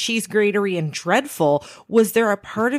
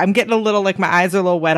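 ordinary talk

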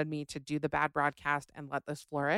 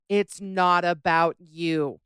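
slow and louder, "It's not about you" spaced out word by word; emphatic slogan; 8 dB louder, slower than usual. Shown as a demonstration. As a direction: spell it out like a sign on the wall. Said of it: "a poster in their home that says".

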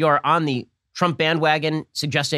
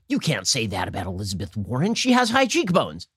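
mock indignation; 4 semitones lower than usual. Media defending Elizabeth Warren, mock indignation.

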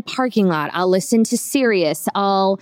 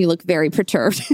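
normal talking voice

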